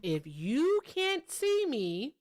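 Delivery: drawn out, very slow. Shown as a demonstration. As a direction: spell it out slowly. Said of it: "repeats the rule, drawn out".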